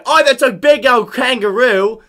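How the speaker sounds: loud, high, slow mock Australian; Australian accent. Shown as a demonstration. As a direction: belt out a hammed-up Australian accent. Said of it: "I'm gonna do an Australian accent".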